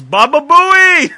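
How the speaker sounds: very loud, high, drawn-out heckle; imitated yell. Imitating somebody walking behind them on camera, yelling. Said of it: "and just yells".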